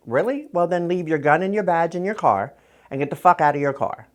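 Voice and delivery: high, loud mock yell daring him; retold furious challenge. To the cop on the bullhorn, a high loud mock yell.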